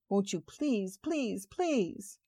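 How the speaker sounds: beg, the voice lifting and thinning on every please; rising desperate plea. Acted. Woman begging God to make him call, rising plea.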